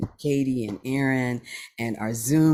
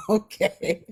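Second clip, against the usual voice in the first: laughing; 9 semitones higher than usual. Real, not acted. Laughs over friends' gift.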